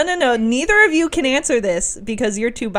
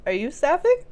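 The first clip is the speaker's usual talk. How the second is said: usual speaking voice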